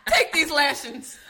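slow, loud bossy mistress; domineering command. Pretend. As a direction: drawl it out like a dominatrix. Acted domme commands.

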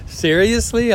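high startled seriously; mock surprise. Told the podcast was bad, high mock surprise. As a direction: act stunned at the news. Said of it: "And I'm like".

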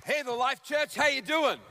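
bright, high call to the crowd; upbeat greeting. Real, not acted. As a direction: greet the congregation cheerfully. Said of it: "I said".

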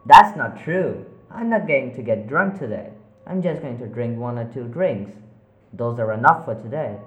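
loud, firm denial; defensive shout. Acted. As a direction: push back hard. Arguing with voices.

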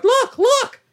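put-on high squeaky kid voice with a laugh; mock little girl. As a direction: pipe it up like an excited child. Little girl points at Edward.

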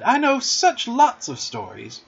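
high, slow, coaxing plea; eager drawn-out pleading; entreating. A narrator's reading. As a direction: draw it out temptingly, pitched high. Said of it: "she entreated".

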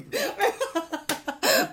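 high, loud real laugh; burst of laughter. Real, not acted. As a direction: crack up at your own joke. Laughing at own impression.

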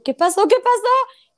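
mock-panicked, climbing high and loud; urgent repeated demand, shooting upward; retold alarm. Retelling her question to her brother, mock alarm.

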